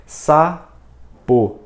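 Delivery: SAPO split into two spaced syllables, SA, PO. Shown as a demonstration. Teacher recalls how reading was taught, syllable by syllable. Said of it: "ele dividia em sílabas".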